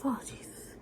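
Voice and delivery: a winded, whispered gasp; spent, breathy exclamation; breathless whisper. Real, not acted. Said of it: "I'm out of breath right now".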